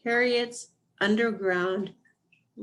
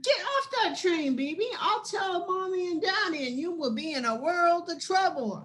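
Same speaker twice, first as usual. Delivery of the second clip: a big sister's half-hearted scolding, higher; mild mock yell; 8 semitones higher than usual. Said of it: "I yelled".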